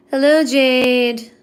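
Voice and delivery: ordinary talk, nothing marked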